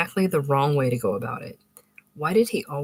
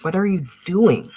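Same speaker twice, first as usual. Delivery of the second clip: aghast; 4 semitones higher, 7 dB louder than usual. A narrator's reading. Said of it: "she'd say aghast".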